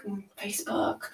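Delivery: hushed sneaky whisper of the word; sly whispered afterthought; whispered aside. Real, not acted. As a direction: whisper it like a secret plug.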